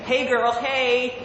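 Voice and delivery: high, sassy American accent. Shown as a demonstration. Brit trying American catchphrase, high American accent. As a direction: sing out a sassy American greeting. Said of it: "my best American accent".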